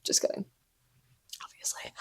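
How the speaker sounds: whispered aside; hushed joking aside. Real, not acted. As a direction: breathe it quietly as a joke.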